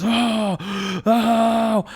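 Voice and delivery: loud, high-pitched repeated scream; panicked wailing yell. Acted. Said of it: "he starts screaming".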